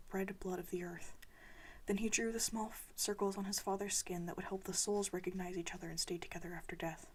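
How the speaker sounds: plain reading voice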